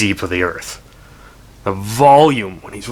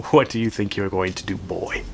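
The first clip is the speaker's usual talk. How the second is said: quiet menacing taunt; 8 dB quieter than usual. Retold or imitated. The Amarok taunts the boy.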